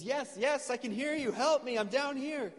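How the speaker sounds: high, eager acted call for help; calling up urgently. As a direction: answer from below, pitch way up. Jack calls up to rescuer.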